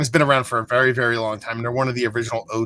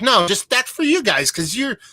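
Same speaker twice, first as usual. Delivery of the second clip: high, warm imitation of the woman refusing money; insistent bartender; 9 semitones higher than usual. Bartender refuses payment from the fans, voiced high and friendly. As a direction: play a woman happily waving off payment. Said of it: "She's like".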